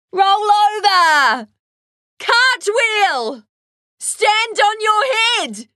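shouting. Acted. Kids shouting game commands.

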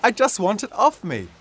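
high and loud, insistent; pleading shout. A narrator's reading. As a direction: demand it be pulled off. Mum still shouting at Dad.

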